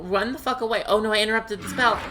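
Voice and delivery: ordinary talk, nothing marked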